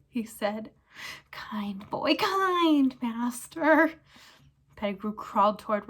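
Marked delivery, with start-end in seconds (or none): 1.3-4.0 whispered then drawn-out high plea; fawning whine. Acted. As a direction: flatter him slowly. Pettigrew flatters Ron.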